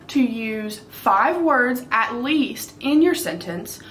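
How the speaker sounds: ordinary delivery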